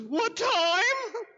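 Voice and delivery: high, tearful falsetto imitation; imitating crying woman. Mayor imitating the crying young woman at his desk, high tearful voice. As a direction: squeak it out like a weeping young woman. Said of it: "That's the way she sounded".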